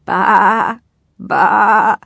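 loud, wavering sheep bleat. A narrator's reading. Sheep calling from inside a crate, bleating loudly. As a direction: bleat like a sheep, two long calls. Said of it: "heard a familiar noise coming from".

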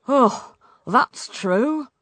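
slow, sighed admission; sighing. A narrator's reading. King gives in to his daughter, sighing. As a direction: draw it out as you concede. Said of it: "said the king with a sigh".

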